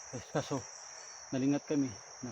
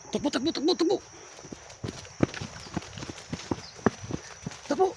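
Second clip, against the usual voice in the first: urgent calling out; 11 semitones higher than usual. Real, not acted. Urging others to run away.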